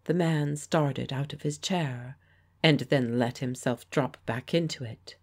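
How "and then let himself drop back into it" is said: ordinary delivery, nothing marked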